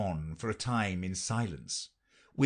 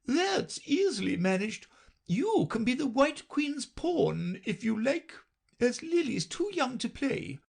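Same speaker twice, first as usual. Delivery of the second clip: very high, pleasant queen voice; a brisk queen offering the girl a role; pleased Red Queen; 14 semitones higher than usual. A narrator's reading. Queen tells Alice she can be a pawn, high and pleasant. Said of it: "smiled pleasantly and said".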